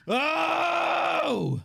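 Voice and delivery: long, high wailed "oh"; mock anguished cry. Host raging at empty sympathy, long high mock wail. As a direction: let out a drawn-out comic howl. Mock outburst after throwing keyboard threat.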